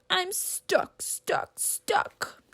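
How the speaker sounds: fading to a whispered stuck, stuck; stuck bear wailing then whispering. Acted. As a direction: wail then fade to a whisper. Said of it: "Bear wails".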